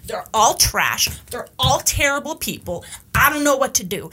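mock distressed complaint, heavy emphasis on each judgement; acted overwrought rant. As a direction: play someone venting in exasperation. Voicing a distressed person ranting about others.

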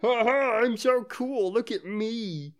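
high, mocking show-off voice; high-pitched mock boasting, drag out the last words. Imitating the man who thinks he's got it, mock show-off. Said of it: "now he's gonna be like".

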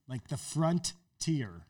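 ordinary talk

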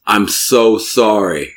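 slow, drawn-out apology; slowly acted heartfelt sorry; retold apology. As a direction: imitate a manager apologizing, stretching each word. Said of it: "They were apologizing to me".

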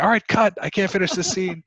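high-pitched pretend actress calling cut; playful imitation of an exasperated actress; imitating J-Lo. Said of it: "being like".

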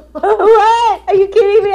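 retold disbelief. New mother hearing Friends wants her tomorrow, laughing disbelief. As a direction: squeal it like you can't believe the call. Told to work the day after birth.

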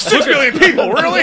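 high, loud announcer parody. Pretend. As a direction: boom it like a TV announcer. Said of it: "an announcer voice".

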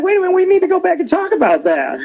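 high, alarmed mock protest; imitating his own startled self; high-pitched retold alarm; alarmed disbelief. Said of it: "I was like".

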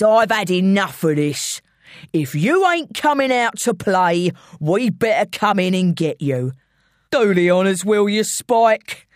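impatient London gangster; London villain, fed up. Acted. Intruder ordering his henchman in, impatient villain. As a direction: issue the threat in broad London.

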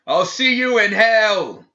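high, loud, slow furious scream. Acted. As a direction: scream the curse from the cell. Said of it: "Gideon screamed".

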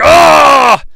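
loud angry roar; mock roar. Narrator imitating Bison losing the rose, mock roar. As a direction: roar like a furious villain. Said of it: "He's like".

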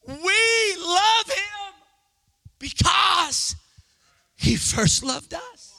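high, slow, ringing proclamation; full-force preaching. Real, not acted. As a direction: proclaim it to the whole congregation.

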